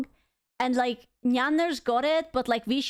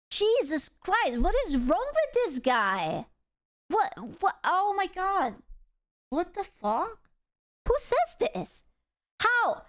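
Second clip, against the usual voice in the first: high-pitched, incredulous exclamations; baffled disbelief; 7 semitones higher than usual. Real, not acted. Streamer reacting to the CEO's words, baffled and incredulous. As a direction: sputter in outraged bafflement, voice jumping high. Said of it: "I am, I am actually baffled".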